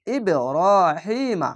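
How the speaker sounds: ইবরাহিমা slow, vowels stretched one alif each; elongated recitation. Shown as a demonstration. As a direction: chant ইবরাহিমা with long vowels. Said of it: "খাড় জাবারে এক আলিফ টান". (টেনে পড়া ইবরাহিমা)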